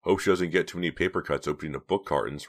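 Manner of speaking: ordinary delivery, nothing marked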